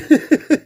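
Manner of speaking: short, very high burst of laughter; a delighted, disbelieving giggle; giddy laugh. Real, not acted. Fan laughing at how fast he found it, giddy. Finding the other autograph quickly.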